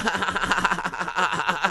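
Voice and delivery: high, forced cackle; mock party-girl laugh. Party girl laughing at her own joke, mock cackle. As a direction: imitate an obnoxious string of ha-ha-has.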